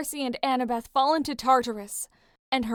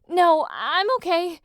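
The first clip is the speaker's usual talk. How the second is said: higher, louder quick denial; hasty; 7 semitones higher, 7 dB louder, slower than usual. A narrator's reading. Piper hurriedly stops Leo fetching Jason, flustered. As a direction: blurt it to cover up. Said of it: "she said hastily".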